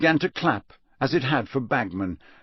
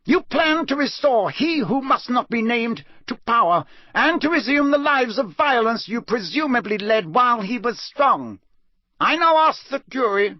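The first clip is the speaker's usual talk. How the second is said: high, hard judge's voice; cold judge reading charges; stern judge; 11 semitones higher than usual. Acted. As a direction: press the charges without mercy. Crouch continues charges.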